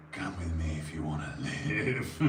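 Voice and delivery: low gravelly film-hero voice; Kyle Reese impression. Streamer trying out a Terminator line, low and gravelly. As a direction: growl it low, breaking into a laugh at the end. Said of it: "say it with a gravelly voice".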